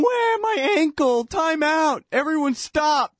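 very high whiny fake wail; hammed-up soccer dive cry; shrill mock agony, slow at the end; mock injured player. Mocking diving soccer players.

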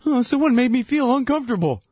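high whiny complaint; mock whine. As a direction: whimper like a delicate student. Mocking sensitive students.

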